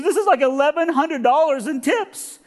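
high-pitched overwrought woman; high, worked-up put-on voice; emotional cleaning lady. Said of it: "I'm sorry to be emotional".

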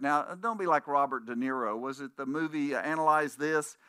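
ordinary delivery

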